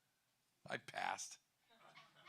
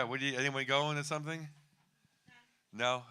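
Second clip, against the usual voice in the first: ordinary talk, nothing marked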